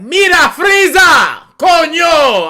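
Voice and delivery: loud, slow, high hammed-up dub yell; Spanish dub shout. Friend mocking the Spanish Dragon Ball dub, shouting loud. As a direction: bellow it like an overacted Spanish voice actor. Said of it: "the best audio way is in Spanish".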